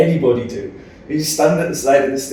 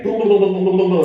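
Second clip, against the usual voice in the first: quick higher gabble of blahs; mock babble; 6 semitones higher, 5 dB louder, faster than usual. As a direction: rattle off blah blah like nonstop chatter. Mimicking friend's nonstop talk.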